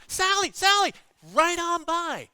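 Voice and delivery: high, loud hail; calling out. Retold or imitated. Stranded preacher hails the Beverly Hills pastor, loud call. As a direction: shout her name after the car.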